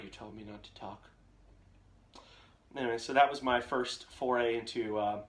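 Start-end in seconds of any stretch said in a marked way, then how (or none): 0.0-1.1 much softer, lower, small voice; timid child. Retold or imitated. Scolded son answering dad after the car trip, small and soft. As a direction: mumble it back like a scolded boy. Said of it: "I'm like".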